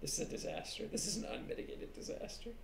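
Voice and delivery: mock-grave whispered commentary; very soft, voiceless whisper; hushed whisper. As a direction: whisper it like an aside on a calamity. Mock commentary on botched intro.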